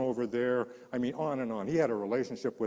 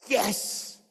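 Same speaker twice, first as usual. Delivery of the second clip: high, loud burst of joy; jubilant yes; 11 semitones higher, 4 dB louder than usual. Retold or imitated. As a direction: leap at the chance. Jubilant reaction to father's request.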